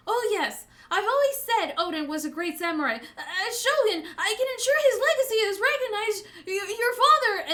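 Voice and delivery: high, flustered grovelling; begging. Acted. Orochi begging Hiyori about her father, grovelling. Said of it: "like, begging".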